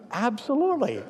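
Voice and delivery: "Absolutely" pitched high and bright with a falling finish; eager yes. Retold or imitated. To the neighbour with the dying dog, an eager high "Absolutely".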